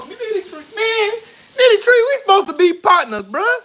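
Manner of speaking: high-pitched wounded plea; mock-hurt whine, pitched well up; hammed-up heartbreak. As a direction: wail at a friend who let you down. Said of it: "you just hurt my heart".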